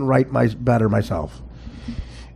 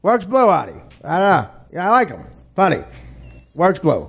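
higher-pitched mimicry in short bursts; Russo impression; 4 semitones higher than usual. Comic replays the host's remarks after the Steve Allen comparison, mimicking impression. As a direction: do the radio host in little approving bursts. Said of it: "he sounded like Steve Allen".

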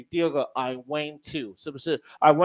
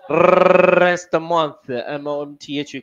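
slow spelling drill; 7 dB louder, much slower than usual. Shown as a demonstration. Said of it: "刚刚都白念的".

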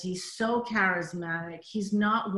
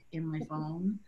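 ordinary talk

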